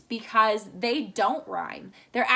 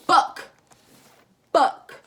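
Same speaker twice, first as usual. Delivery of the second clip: Book as one loud, isolated beat; counted-out syllable; 6 semitones higher, 7 dB louder than usual. Shown as a demonstration. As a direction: open wide on a single punched Book. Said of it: "did my mouth open".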